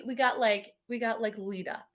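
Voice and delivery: ordinary talk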